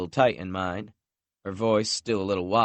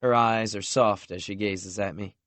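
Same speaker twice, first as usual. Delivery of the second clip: ordinary delivery, nothing marked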